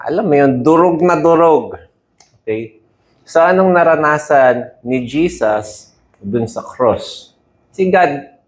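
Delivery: normal talking voice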